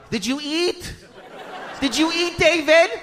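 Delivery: high-pitched nagging questions; anxious mother. Acted. Neurotic mom phoning son about food, anxious nagging. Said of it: "She has anxiety".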